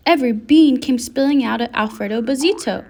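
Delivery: usual speaking voice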